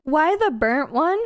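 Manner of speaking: usual speaking voice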